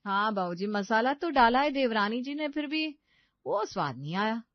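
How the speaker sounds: agree, then pick fault with the dish; critical sister-in-law. Acted.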